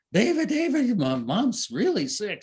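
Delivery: high, loud alarmed calling; urgent call. Retold or imitated. Daughter running down the stairs calling David about her sick mom.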